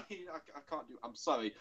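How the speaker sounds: ordinary delivery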